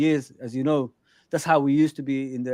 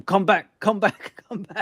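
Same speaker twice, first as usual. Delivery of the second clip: higher-pitched, drawn-out imitation of a shout; mock touchline shout; 5 semitones higher, slower than usual. Manager calling a player back, mock shout. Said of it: "Arne Slot shouting at him".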